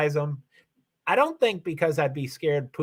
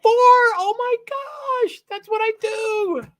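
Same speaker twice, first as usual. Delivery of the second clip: excited squeal; 5 dB louder, slower than usual. Real, not acted. Reacting to a chat comment.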